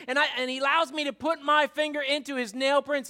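a doubter who has had enough; mad Thomas. Retold or imitated. Thomas snapping at the disciples, angry. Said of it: "He's a little bit mad".

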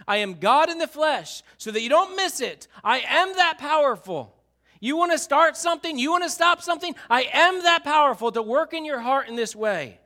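high, loud, forceful declaration; fervent preaching. Real, not acted. Pastor voicing Jesus' power, high and loud. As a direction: proclaim it with full conviction.

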